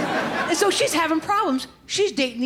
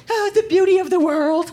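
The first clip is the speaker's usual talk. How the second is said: high mock-tearful wail; passive-aggressive crying; 4 semitones higher, 4 dB louder than usual. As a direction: pretend to cry, overcome with feeling. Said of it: "You're crying over the beauty".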